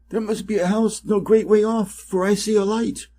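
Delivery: a rooster spotting a light far off; excited rooster call. Acted. Rooster calls to companions.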